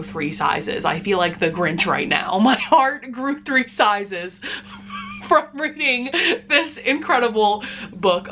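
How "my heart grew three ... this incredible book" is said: giggling through the words, higher; laughing. Real, not acted. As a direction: laugh while gushing. Laughing at own Grinch joke.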